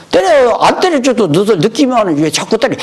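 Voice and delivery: exasperated teacher brushing off pleas; teacher snapping at kneeling students; mock exasperated shout. Teacher refuses students' request.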